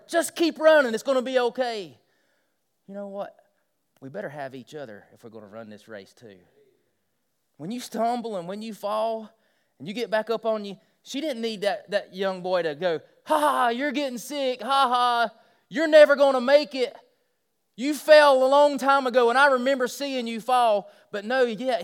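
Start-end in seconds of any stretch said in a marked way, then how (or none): 0.1-2.0 higher, louder call to keep running; hollering encouragement. Retold or imitated. Teenage boy hollering to a girl getting sick mid-race, loud and encouraging. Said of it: "he's hollering to her". 13.2-20.9 higher, louder jeering with fake laughter; mocking taunt. Imagined mean boy jeering at a girl who fell, loud mock laughter.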